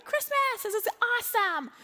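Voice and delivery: high-pitched put-on excitement; hammed-up cheery parent; forced cheer. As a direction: hype up the kids through the stress. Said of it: "trying to be cheery with our boys".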